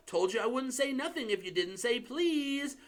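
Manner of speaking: high-pitched gleeful gloat. Acted. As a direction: rub it in with glee. Peeves gloating.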